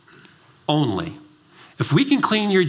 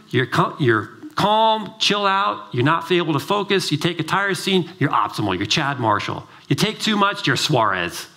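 ordinary talk

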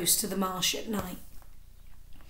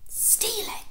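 put-on high, breathy shocked child voice; gasping little boy; 12 semitones higher, 5 dB quieter than usual. Little boy shocked by his mother's words, a high breathy gasp. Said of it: "gasped Bert".

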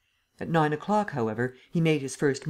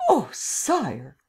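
shocked protest; 2 semitones higher than usual. A narrator's reading. Waldemar is accused by the Emperor, shocked protest. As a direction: gasp and protest, pitch leaping then dropping.

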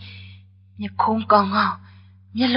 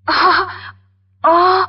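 flustered gasp; 8 semitones higher, 5 dB louder than usual. Acted. Flustered at handsome visitor.

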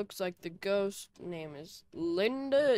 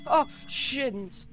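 sharp, higher, louder scared curse; frightened curse; 5 semitones higher, 5 dB louder than usual. Real, not acted. Ghost hunting alone and suddenly scared: frightened louder curse. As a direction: blurt it out in fright.

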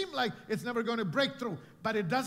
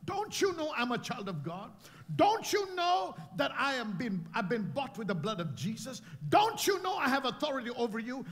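rebuking command; 2 semitones higher than usual. Shown as a demonstration. Believer shouting at sickness to rise up. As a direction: command your situation with force. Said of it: "how you need to talk to your situation".

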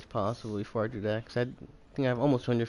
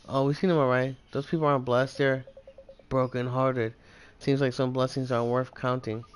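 ordinary talk

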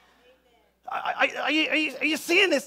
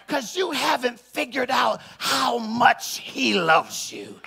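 raised, insistent sermon voice; forceful preaching; 3 semitones higher, 6 dB louder than usual. Real, not acted. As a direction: press the point hard on the congregation.

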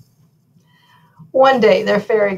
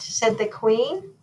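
plain reading voice

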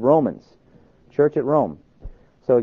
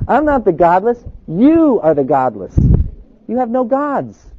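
loud, high, emphatic turning of the charge; defiant retort; 7 semitones higher, 6 dB louder than usual. Acted. Polycarp answers the crowd in the stadium, defiant. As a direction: throw it back, leaning hard on "You".

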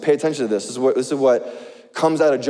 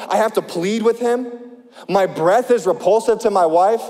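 earnest preacher reading scripture; 4 semitones higher than usual. Acted. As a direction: read Job's complaint with feeling. Reads Job's lament.

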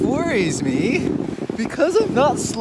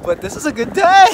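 excited; 7 semitones higher, 5 dB louder, faster than usual. Real, not acted. Sledding fast.

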